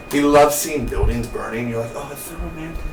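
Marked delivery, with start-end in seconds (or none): 1.9-2.8 soft, high, dreamy gush; hushed mock-romantic sigh; mock swooning. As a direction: coo it quietly, pitched up. Mocking his love of burning buildings.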